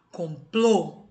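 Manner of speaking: complô with a loud final plô; stress on plô. Shown as a demonstration. Teacher showing the class the oxytone stress, loud. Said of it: "terminada em O".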